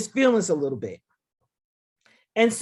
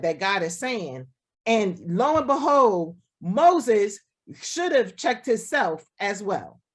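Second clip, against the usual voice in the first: ordinary delivery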